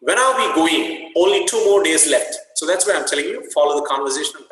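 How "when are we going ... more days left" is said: put-on higher, louder lilting girl's line; teacher voicing an excited friend in a sing-song tone. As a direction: sing the question a little, like an eager friend. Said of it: "she says in her sing-song voice".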